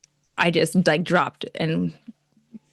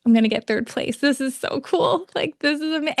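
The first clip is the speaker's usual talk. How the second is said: reliving the thrill, voice climbing higher; excited inner thoughts; 9 semitones higher than usual. Retold or imitated. Pageant contestant reliving her crowning thrill. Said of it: "'cause I was like".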